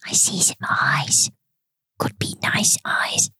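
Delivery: whispering. Acted. Whispering about eyes in cave.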